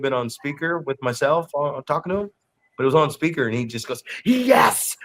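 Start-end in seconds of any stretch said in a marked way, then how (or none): 4.2-5.0 imitating an eager yes, much higher; enthusiastic yes. Kid who forgot to hang up the phone, enthusiastic yes. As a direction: let the joy jump out of one word. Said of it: "with such enthusiasm".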